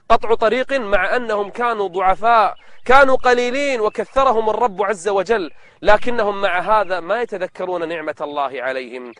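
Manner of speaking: ordinary delivery, nothing marked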